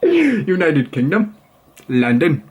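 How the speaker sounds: attempted English accent coming out Australian; English accent turned Aussie. Vlogger failing at London, English accent that sounds Australian. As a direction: imitate a Londoner but slip into an Australian accent. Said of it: "sounds like a straight friggin' Aussie".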